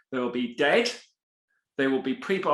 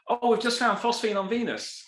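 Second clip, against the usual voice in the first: higher, hammed-up eager announcement; mock excitement; 7 semitones higher than usual. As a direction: parody an overeager discovery claim. Mocking overeager scientists' claims.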